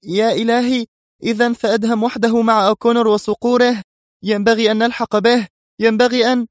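very high, urgent alarm; frantic woman's outcry; crying out. A narrator's reading. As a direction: exclaim in mounting panic, pitched far up. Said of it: "وهي تهتف".

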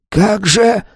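high, breathless shocked gasp. A narrator's reading. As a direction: choke out the words in shock. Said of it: "задохнулся старик". (задыхаясь)

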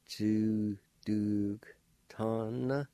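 "Chu, duk, ta, na" slowly in separated syllables; measured "Chu, duk, ta, na" for learners to copy; slow pronunciation. Shown as a demonstration. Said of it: "like this".

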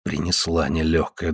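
low, hushed grumbling thought; inner thought. A narrator's reading. Andrei silently rues the visitor's arrival, low inner thought. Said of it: "думал Андрей".

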